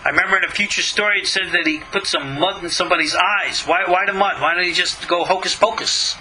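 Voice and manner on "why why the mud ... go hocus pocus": ordinary delivery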